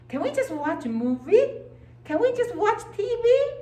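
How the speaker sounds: put-on high, loud pleading childish voice; whiny id. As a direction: whine like a kid begging for TV. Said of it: "And my id say".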